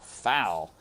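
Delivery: ordinary delivery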